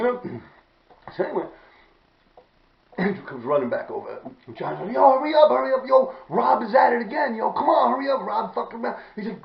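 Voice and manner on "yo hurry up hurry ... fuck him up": high, raised-voice urging; excited acted goading; urgent egging-on. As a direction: imitate a friend hurrying someone along. Acts out friend urging him on.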